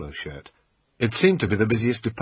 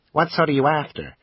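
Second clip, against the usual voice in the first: a higher, louder American salesman pitching the camera; pawn shop salesman; 7 semitones higher, 3 dB louder than usual. Acted. Assistant pitching the camera to the customer, salesman voice. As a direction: sell it like a pushy shop assistant.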